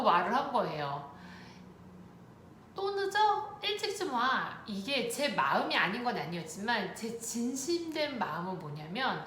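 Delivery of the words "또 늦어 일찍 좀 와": mildly irritated, pitched up at the start; a re-enacted nag with a touch of annoyance; nagging complaint. Tired wife asking husband to come home, mildly nagging.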